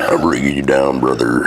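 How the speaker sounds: low gruff bro voice; deep dude voice. Pretend. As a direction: drop the voice low, call him brother. Echo in deep put-on voice.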